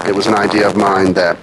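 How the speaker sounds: ordinary talk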